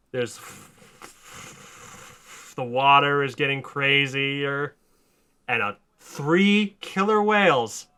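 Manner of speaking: normal talking voice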